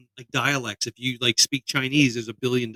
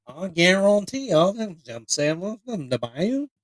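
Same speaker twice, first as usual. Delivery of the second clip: garbled mock Cajun, Louisiana style; sing-song parody of Louisiana bayou talk; imitated Louisiana accent; 5 semitones higher, slower than usual. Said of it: "people in Louisiana are like".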